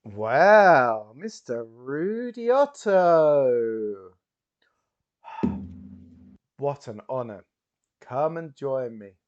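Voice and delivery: loud, slow drawn-out name; theatrical host welcome; grand showman welcome. Acted. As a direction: savor the name with mock awe. Bowman welcomes Rudy grandly.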